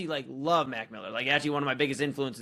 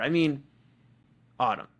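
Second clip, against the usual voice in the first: normal talking voice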